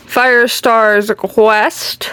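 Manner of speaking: very slow, stretched-out title; oddly drawn out. Pretend. Vlogger listing her books stretches one title out slowly. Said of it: "I don't know why I said it like that".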